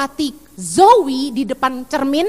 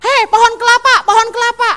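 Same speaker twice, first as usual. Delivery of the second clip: higher, louder taunting shout; mild mock yell; 6 semitones higher, 4 dB louder than usual. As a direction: call out a schoolyard taunt. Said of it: "saya suka diteriakin gini".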